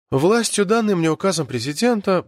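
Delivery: ordinary delivery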